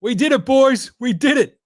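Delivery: high, loud celebration; real triumphant cheer; jubilant. Real, not acted. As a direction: crow it to the whole crew. Celebrating draft pick.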